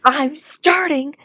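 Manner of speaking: high, slow, loud protest; exasperated yell. Pretend. Actor answering the pushy director, exasperated yell. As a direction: yell back, stretching each word.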